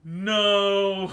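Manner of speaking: high, loud mock wail; hammed-up long NO; retold girl's refusal. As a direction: stretch it out like a pouting child. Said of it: "And she's like".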